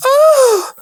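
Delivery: loud high-pitched mock moan; moan overheard. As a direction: imitate a woman's moan through the window. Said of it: "all I hear".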